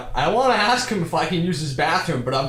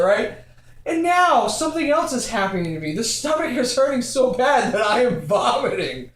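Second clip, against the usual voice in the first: ordinary talk, nothing marked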